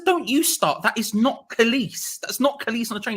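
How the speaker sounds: high-pitched, insistent mock protest; retold exasperated denial. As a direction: shut it down in comic disbelief.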